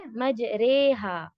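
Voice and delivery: slow مج رے ہا with a long "ray" before "haa"; tilted imala vowel. Shown as a demonstration. Teacher repeating the model word for students, slow imala. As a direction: draw out مج رے ہا as "maj-ray-haa". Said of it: "اس کو آپ tilt کریں".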